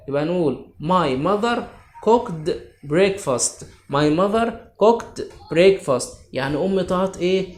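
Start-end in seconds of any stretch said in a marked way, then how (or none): 0.8-6.1 slow, spaced-out English example; slow dictation. Shown as a demonstration. Teacher modelling a sentence for the class, slow dictation. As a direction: spell it out slowly for the pupils.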